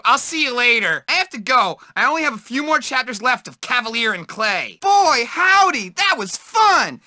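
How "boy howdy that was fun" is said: high, thrilled exclaim; excited. Acted. Nerd after a ride, high and thrilled. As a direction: gush it with delight. Game bark, excited take.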